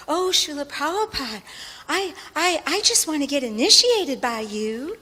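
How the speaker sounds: high, gushing Southern belle; Southern accent. Retold or imitated. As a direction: plead sweetly in a Southern accent, pitch swooping up. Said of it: "say it in a Southern accent".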